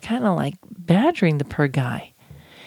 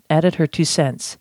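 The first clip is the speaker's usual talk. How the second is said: ordinary delivery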